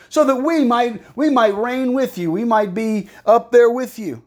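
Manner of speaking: high-pitched mock wishing; sarcastic. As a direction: plead in exaggerated false longing. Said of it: "He's being sarcastic".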